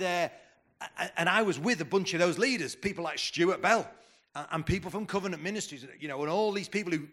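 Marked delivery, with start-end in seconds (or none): none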